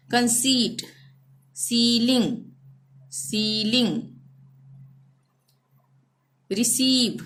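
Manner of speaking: stretched ई in कंसीट, रिसीव, प्रोटीन; careful word list, every ई held long; drawn-out ee. Shown as a demonstration. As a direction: model each word with a long ई, as in सीलिंग. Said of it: "ई हम खींच रहे हैं ना".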